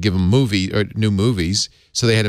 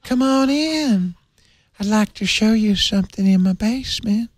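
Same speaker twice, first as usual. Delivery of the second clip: Herbert the pervert; 12 semitones higher than usual. Acted. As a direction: lure them in with a high, creepy voice. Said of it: "like Herbert the pervert".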